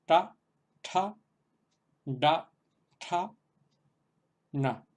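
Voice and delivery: "Ta, ta, da, ta, na" each retroflex and distinct; retroflex series drill. Shown as a demonstration. Teacher repeating the full series for the class. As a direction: run the whole retroflex row clearly. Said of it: "vamos a repetir toda la serie".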